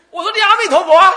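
high, loud, laughing yell; retold shout. Old master yelling the Buddha's name at a deaf man, laughing. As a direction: bellow it so a deaf man hears.

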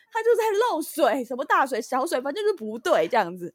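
high, loud, heated retort; angry outburst. Retold or imitated. Retelling her own furious reply to the repairman. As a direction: fire back in a burst of anger. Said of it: "我听到就整个火很大".

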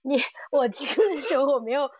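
usual speaking voice

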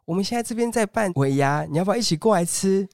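high, sugary phone voice calling his name; sweet saleswoman caller. Retold or imitated. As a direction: coax him like a pushy recruiter. Woman caller invites to party. (高声甜腻的女推销员)

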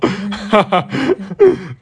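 embarrassed laugh at her own panic; loud falling laugh. Real, not acted. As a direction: let a real laugh burst and fall away.

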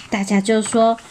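usual speaking voice

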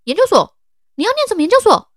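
high, loud disbelieving question; the professor in growing shock. Retold or imitated. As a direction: raise pitch and volume in disbelief.

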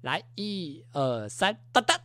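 slow count. Retold or imitated. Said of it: "他就说".

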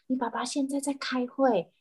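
calm the cat down kindly; gentle reply. Retold or imitated. The host soothes the cat, explaining its dad is in a meeting, gently. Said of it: "然后我就说".

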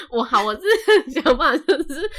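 talking through a laugh; words bubbling with laughter. Real, not acted. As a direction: say it while still laughing. Laughing over own words.

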